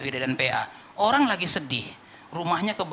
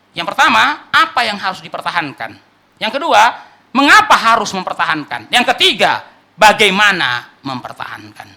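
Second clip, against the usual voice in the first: emphatic points; 3 semitones higher, 10 dB louder than usual. Real, not acted. Preacher announces three points.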